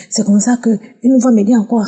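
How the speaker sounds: ordinary talk, nothing marked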